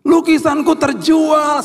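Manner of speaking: very high, drawn-out joyful shout; excited shout. Retold or imitated. Daughter announcing her painting sold, excited high shout. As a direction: shriek it slowly like a thrilled child. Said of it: "dia berteriak ketika dia lihat".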